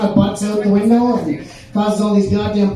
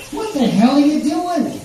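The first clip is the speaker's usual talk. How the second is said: high, drawn-out mock disbelief; incredulous question; 6 semitones higher than usual. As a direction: stretch it out in hammed-up astonishment. Iguana spots bear smoking a joint.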